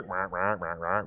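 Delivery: low, soft "rah rah" babble; lightly mocked background chatter; mumbled noise. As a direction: make it sound like far-off noise. Noise heard but not listened to.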